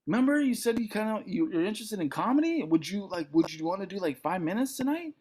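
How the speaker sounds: lightly voiced friend asking a favour; a hint of an upbeat comedian; friend's offer. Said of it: "he's like".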